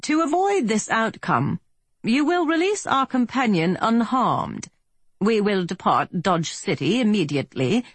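measured upper-class British, hurrying a little; even British tone. A narrator's reading. Briton reasons with the marshal, even but slightly rushed British. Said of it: "This accent was British".

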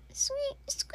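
tiny high whispered squeaks; hamster squeak imitation. Owner imitating her hamster squeaking at night. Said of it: "I don't even know how to do it".

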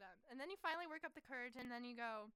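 ordinary delivery

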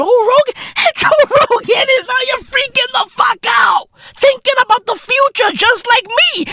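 frantic high squeal; hammed-up hysterical rant; high-pitched mock panic. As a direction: freak out comically at the top of the voice. Comic panic about the future.

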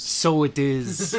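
slow, laughing Irish drawl of 'So it is'; mock Irish accent. Cohost echoes the Irish tag line slowly, laughing, in a stage Irish lilt.

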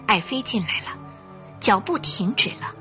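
normal talking voice

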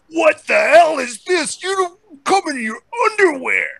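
high-pitched mock shout; hammed-up coach's scolding yell; retold coach screaming. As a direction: bawl it out like an outraged gym coach. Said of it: "he's, like, screaming at me".

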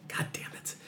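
under-the-breath whispered curse. Real, not acted. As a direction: hiss it to yourself. Muttered frustration after pun missed.

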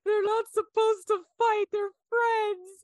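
high, loud tearful wail; mock bawling. Crying to husband over a movie battle scene, mock bawling. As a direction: wail it like an overemotional crier. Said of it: "And I just start bawling".

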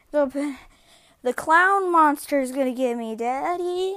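mock-scared kid pleading with his dad; scared little kid. Son tells his dad the clown monster is coming, frightened.

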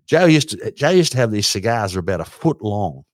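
ordinary delivery

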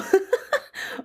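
short breathy giggle; light high laugh. Real, not acted. Laughs about constant reinventing.

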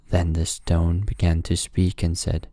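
usual speaking voice

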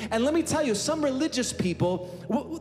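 high, ringing sermon voice building to a climax; preaching over the church music; fiery preaching. Acted. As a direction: preach it out to the congregation. Preaching at full force.

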